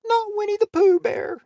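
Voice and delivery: very high, thin cartoon bear voice; Winnie the Pooh voice. Host teasing callers, doing a very high Winnie the Pooh voice. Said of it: "That's my Winnie impression".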